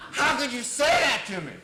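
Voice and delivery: loud, high mock outrage; indignant protest. Client challenging the therapist's insult, loud indignation. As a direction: protest loudly, imitating an affronted client.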